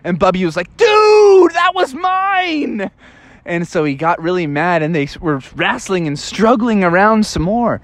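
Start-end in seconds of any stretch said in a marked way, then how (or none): 0.8-2.9 outraged shout. Acted. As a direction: yell high and slow at the thief. Bubby loses his fish.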